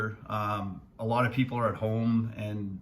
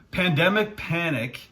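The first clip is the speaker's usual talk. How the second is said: higher, louder playful repeat; amused tongue-twister retry, pitched up; 6 semitones higher, 4 dB louder than usual. Shown as a demonstration. Finance vlogger retries an accidental tongue twister after laughing, playfully. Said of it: "say that ten times fast".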